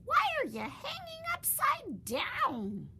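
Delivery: puzzled bat calls up; high slow strange creature voice; loud bat voice. Acted. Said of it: "a loud voice said".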